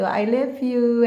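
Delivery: "I love you" slow and gentle, each word drawn out; gentle confession. Shown as a demonstration. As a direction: say "I love you" slowly, gently, like a brave lover. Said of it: "Bilang aja gentle gitu". ("I love you" diucapkan pelan dan lembut)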